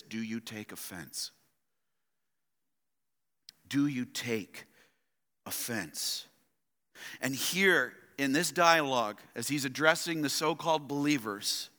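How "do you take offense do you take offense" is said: low, soft and searching; hushed, low-pitched challenge; quiet, pointed question. Acted. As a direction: put the question gently, letting it land. Preacher puts Jesus' question to congregation.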